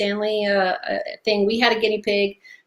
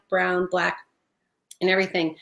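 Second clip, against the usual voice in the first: ordinary talk, nothing marked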